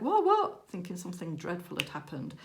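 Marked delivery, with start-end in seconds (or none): 0.0-0.7 high, loud startled what; alarmed reply. Retold or imitated. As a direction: answer as if something dreadful happened. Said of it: "And she was like".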